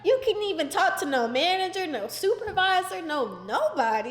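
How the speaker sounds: ordinary delivery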